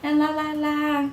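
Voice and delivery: mock the auntie's pep talk; imitating auntie. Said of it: "talking about".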